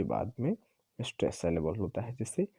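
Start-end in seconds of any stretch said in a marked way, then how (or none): none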